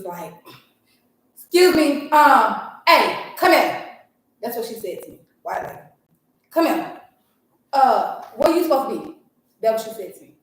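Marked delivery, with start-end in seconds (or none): 1.3-3.9 louder, higher imitation of a curt lady; rude summons. Lady calls over a parent at the school door, imitated rude summons. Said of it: "she was just rude". 6.5-9.2 loud, raised-pitch mock of her demand; rude questioning. Lady challenges a parent at the school, imitated rude questioning. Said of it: "she was just rude".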